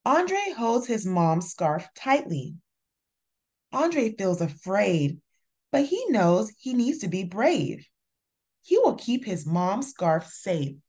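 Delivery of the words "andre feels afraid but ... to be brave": usual speaking voice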